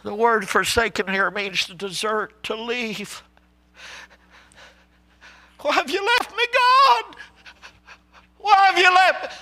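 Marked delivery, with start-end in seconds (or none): 5.6-9.3 high cry of why have you left me; anguished plea. Acted. Preacher voicing Jesus pleading with God, high cry.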